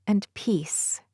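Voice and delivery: usual speaking voice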